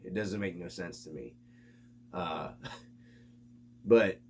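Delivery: ordinary talk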